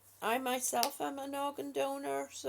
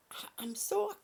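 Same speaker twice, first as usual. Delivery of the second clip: whisper; 8 semitones higher than usual. Real, not acted. Emotional aside to struggling viewer.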